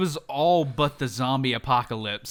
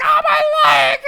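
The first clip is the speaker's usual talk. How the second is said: yelping, much higher and louder; hammed-up injured yell; mock pained shout; 15 dB louder than usual. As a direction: cry out like an injured victim, hammed up. Retelling victim in sound clip.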